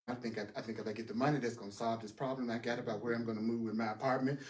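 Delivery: low, soft run of private thought; murmured inner reasoning. Acted. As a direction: think it through under your breath, low and quiet. Voices a student's habitual worried reasoning.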